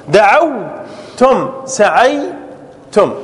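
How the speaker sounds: ضربتم pulled out slowly to the final م; slow drawn-out demo. Shown as a demonstration. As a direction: stretch the ending for the class. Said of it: "teacher drawing out verb forms". (نطق ضربتم ببطء شديد)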